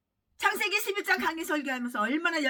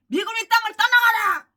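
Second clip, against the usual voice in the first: higher, louder mocking rally shout; mock protest slogan; 9 semitones higher, 10 dB louder than usual. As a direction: bellow it like a street protester. Quoting an anti-US slogan. (반미 구호를 비꼬듯 외치는 흉내)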